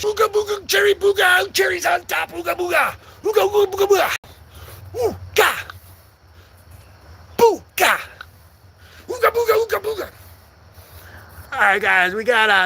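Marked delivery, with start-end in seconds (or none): 0.0-10.1 high-pitched nonsense chant; mock native tongue. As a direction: chatter silly syllables high up. Clowns in a made-up language.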